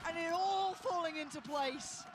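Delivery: high, loud hype call; excited. Real, not acted. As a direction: build the hype as the round closes. Caster calling the round win.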